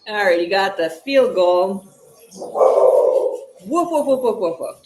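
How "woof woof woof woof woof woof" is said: barking like a dog. Shown as a demonstration. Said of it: "Dog says".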